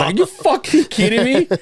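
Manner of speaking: very high, comic outrage; high-pitched, hammed-up indignation; retold incredulity. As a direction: squeal it in mock disbelief. Retold outrage at brother leaving.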